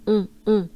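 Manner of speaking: un said through the nose, French style; nasal un, rounded; French nasal vowel demo. Shown as a demonstration. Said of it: "We want to pronounce the un".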